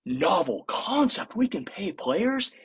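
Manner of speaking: slow, high sarcastic wonder; drawn-out pretend surprise, rising at the end; mock amazement. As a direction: feign astonishment, drawing the words out. Sarcastic shock at paying players.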